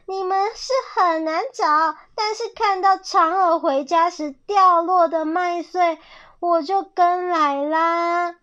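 high, loud villain voice; sly, triumphant storybook fox; gloating fox. A narrator's reading. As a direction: crow over the cornered prey. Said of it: "小心眼笑着说".

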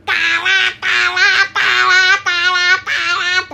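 loud stomping 嘎啦 sounds; each 嘎啦 a heavy hoof; heavy clattering steps. A narrator's reading. Big goat's heavy hooves on the bridge, loud.

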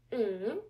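"Mm" dipping down, then rising back up; third tone demo. Shown as a demonstration. Teacher showing learners the Chinese third tone, humming a dip and rise. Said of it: "The third intonation sounds like this".

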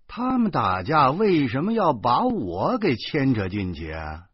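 ordinary delivery

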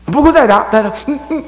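high, loud threat with grunts; mock scolding. Acting out beating a child who won't cry.

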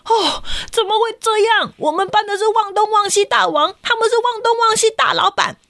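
put-on high, outraged kid voice; can't believe the rival's title; indignant shock. A classmate reacts to the other class's champion.

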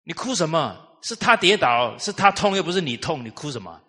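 scolding mother. Retold or imitated. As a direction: play the exasperated mother, voice up. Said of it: "他妈说".

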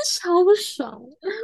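high laugh breaking into words; laughing fit. Real, not acted. Telling friend about teacher, laughing hard. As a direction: crack up while complaining.

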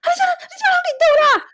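mother panicking over her son; panicked mom. Retold or imitated. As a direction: shriek it like a frantic mother. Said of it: "她就说".

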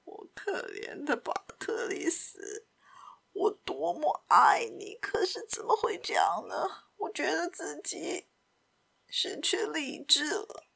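put-on whispery, breaking old-man lament with unsteady pitch; anguished Simon. A narrator's reading. Simon confessing his madness to Patrice, anguished whisper.

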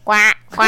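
loud imitated crow caws. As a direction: caw like a crow. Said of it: "小鸟".

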